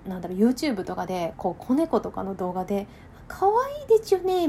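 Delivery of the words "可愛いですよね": high, gushing cutesy voice; talking to a baby. Retold or imitated. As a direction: coo like a doting pet owner. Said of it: "みたいな".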